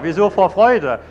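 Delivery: loud, high mock bewilderment; baffled husband. Husband questioning wife's joy, loud bewilderment. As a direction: demand a reason, high and loud.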